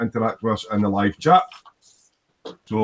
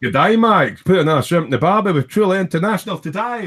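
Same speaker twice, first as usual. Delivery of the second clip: hammed-up Australian, higher and louder; bad Australian accent; 8 semitones higher, 4 dB louder than usual. Host joking to cohost about Ken's Australia in a bad Australian accent. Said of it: "Yeah, the bad accents".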